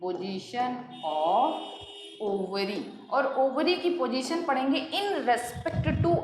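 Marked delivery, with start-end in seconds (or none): 0.0-2.8 very slow dictation. Shown as a demonstration. Teacher repeating the term for notes, very slow. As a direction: stretch "position of ... ovary" word by word.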